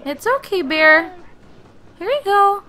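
high, soothing pet talk; comforting the bear. Real, not acted. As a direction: coo to the sad cub. Comforting the crying bear cub.

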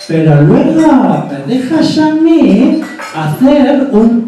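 slow, drawn-out singsong cook; Eva. Acted. Chef's sister announcing her dessert recipe, slow singsong. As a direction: stretch the words in lilting swoops. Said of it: "Entonces Eva diría".

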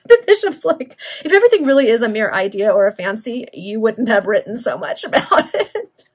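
loud remark breaking into laughter; amused, laughing. Real, not acted. Reader explains the bishop's jibe, laughing aloud. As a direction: paraphrase the joke, cracking up at the end.

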